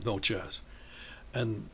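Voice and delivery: ordinary talk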